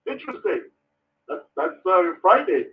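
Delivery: ordinary talk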